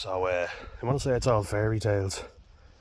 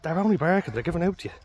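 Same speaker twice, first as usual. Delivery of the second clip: talking to the dog; 10 semitones higher, 4 dB louder, faster than usual. Real, not acted. Explaining to dog about barking dogs.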